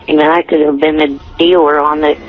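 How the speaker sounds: ordinary talk, nothing marked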